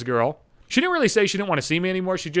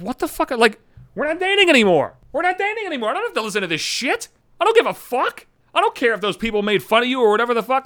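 inner exasperated rant; 8 semitones higher than usual. Retold or imitated.

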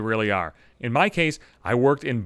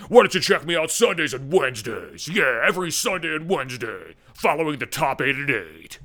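cheesy radio DJ plug; hammed-up shock-jock promo; douchebag zookeeper voice. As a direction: sell it like a smarmy morning host. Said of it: "in fucking douchebag zookeeper voice".

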